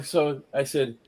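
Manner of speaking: normal talking voice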